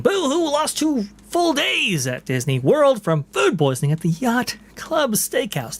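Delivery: high, whiny mock sob; whiny boo-hoo voice; mocking whine. Mocking whiny quote of commenter.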